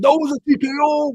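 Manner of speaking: high, loud mock protest; mock mad. Voicing an imagined angry fighter's complaint, mock mad. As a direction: protest it like a sore loser, loudly. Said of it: "gonna be all mad".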